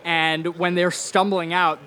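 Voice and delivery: ordinary talk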